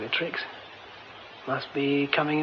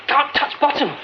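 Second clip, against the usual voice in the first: panic; 8 semitones higher, 5 dB louder than usual. Acted. Sinking in a well.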